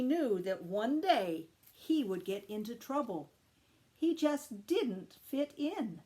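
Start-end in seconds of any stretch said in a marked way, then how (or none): none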